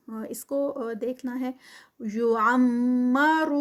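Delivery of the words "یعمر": slow, drawn-out tajweed recitation; Quranic recitation. Shown as a demonstration. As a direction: chant it slowly, stretching each sound. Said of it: "عین کو proper جو ہے وہ حلق سے ادا کریں". (آہستہ کھینچ کر تلاوت)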